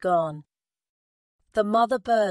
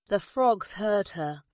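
usual speaking voice

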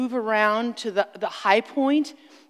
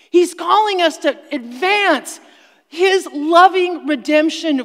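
rally the congregation to take back the land; full-force revival preaching; fiery preaching; 4 semitones higher, 8 dB louder than usual. Acted.

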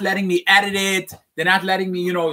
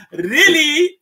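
"really" much higher and louder, rising; emphatic really; 10 semitones higher, 5 dB louder than usual. Acted. Rebbe urging his followers, a loud rising "really". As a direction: push "really" up into a loud, climbing plea.

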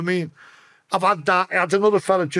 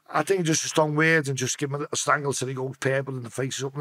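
ordinary talk

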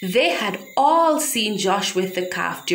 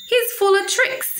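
put-on excited boy; 12 semitones higher than usual. Josh proudly shows off calf.

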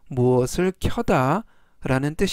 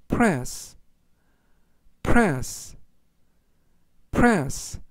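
slow word reading; much slower than usual. Shown as a demonstration. Teacher reading vocabulary for learners to repeat, slowly. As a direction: press read slowly as a single word. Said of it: "제가 천천히 세 번씩 읽어드리고요".

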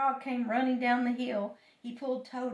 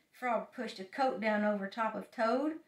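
ordinary delivery

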